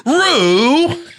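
very high, loud put-on holler; mock sitcom call. Imagining Euphoria as a comedy, high mock sitcom call. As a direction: sing out the name like a sitcom mother. Calling a Euphoria character in same voice.

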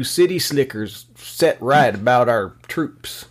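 put-on folksy farm boy voice; slow aw-shucks country drawl; aw shucks act. Mocking singer as farm boy.

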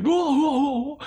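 very high mocking hoots; imitated moviegoer laughing. Host mimics theater guy as Yoda climbs Chewbacca, high mocking whoops. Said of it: "he was laughing at everything".

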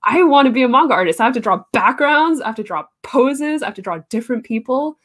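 mock-earnest determination, punching each item; eager younger self. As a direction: act out a kid's big resolve. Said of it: "because I was like".

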